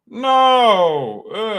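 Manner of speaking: long, high, loud wail of refusal; loud dismayed cry. Real, not acted. Fan refusing to go to Washington, loud dismayed cry. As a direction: wail it out in protest.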